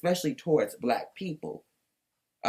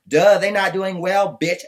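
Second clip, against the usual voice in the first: Dorinda impression; 4 semitones higher, 8 dB louder, slower than usual. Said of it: "as Dorinda would say".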